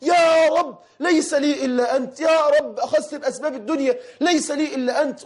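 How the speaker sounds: retold desperate prayer shouted at full voice; desperate crying out to God. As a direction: re-enact a man screaming to the heavens in despair. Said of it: "وأنا أصرخ بعلو ما فيا".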